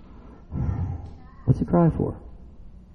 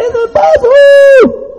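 very high, loud whoop; excited townspeople; 10 dB louder, much slower than usual. Acted. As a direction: whoop like a town gone wild. Acting out excited town.

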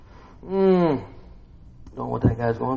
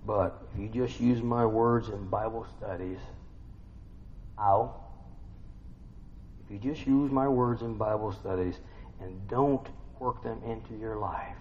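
ordinary talk

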